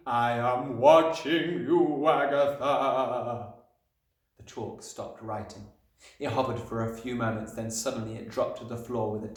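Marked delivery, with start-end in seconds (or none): 0.1-3.5 very slow, drawn-out haunting threat; ghostly Magnus. A narrator's reading. Chalk warning Agatha it watches, eerie and drawn out. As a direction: stretch every word out, especially the name.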